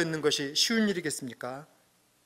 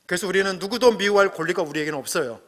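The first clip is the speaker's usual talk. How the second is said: ordinary talk, nothing marked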